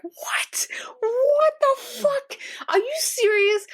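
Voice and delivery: high, breathy whisper of disbelief, rising sharply; stunned viewer gasping what the hell; shocked disbelief. Retold or imitated. Viewer reacting to the guardian's obliviousness, stunned disbelief.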